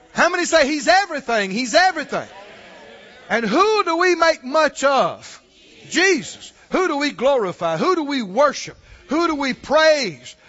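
loud, rising preacher's call and response; full-force preaching. Acted. Preacher calling out to the congregation, loud and fervent. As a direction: build it to a shout for the congregation.

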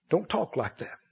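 dropping to a near hush on the repeat. Real, not acted. Preacher quietly repeating his warning to the church. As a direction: repeat it softly, almost to yourself. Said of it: "repeats softer".

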